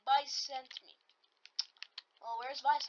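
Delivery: normal talking voice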